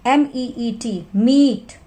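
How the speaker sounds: very slow spelling, मीट with a long ई; long vowel demo. Shown as a demonstration. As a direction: spell slowly, stretch ई in मीट. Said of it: "first जो vowel है उसकी long sound".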